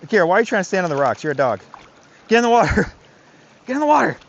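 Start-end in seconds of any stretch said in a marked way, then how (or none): 2.3-4.2 higher, louder, with a laugh; laughing command. Real, not acted. Trainer laughs at his dog on the rocks, loud high command. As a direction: order the dog into the water.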